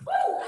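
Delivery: high whooping cheer; excited woo. Real, not acted. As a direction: whoop to pump them up. Cheering mid-set.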